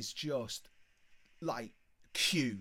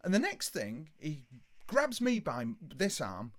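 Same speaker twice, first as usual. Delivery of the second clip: ordinary talk, nothing marked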